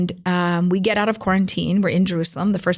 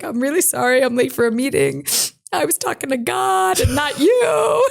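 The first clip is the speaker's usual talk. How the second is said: high, laughing words that crack toward a shout; laughing apology; 6 semitones higher than usual. Retold or imitated. Telling the producers she was late from praying, laughing and high. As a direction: blurt out the apology through a laugh.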